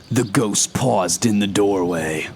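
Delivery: ordinary delivery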